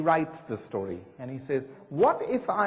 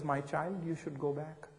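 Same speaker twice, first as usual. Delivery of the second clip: soft, tender elder's answer; soft-spoken nun gently sending her home; gentle softer reply; 9 dB quieter than usual. Retold or imitated. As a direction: hush it down kindly, like a wise old nun. Mother Teresa answers young woman.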